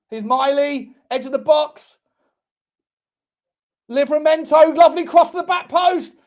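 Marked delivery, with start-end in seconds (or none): 3.9-6.1 raised, louder match call; excited play-by-play. Real, not acted. Commentator calling a dangerous cross to viewers, excited call. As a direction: lift and push the voice as the cross comes in. Live attack into the box.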